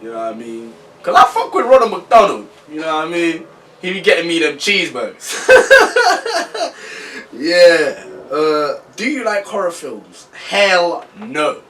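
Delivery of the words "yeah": laughing. Real, not acted. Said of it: "laughing at own joke".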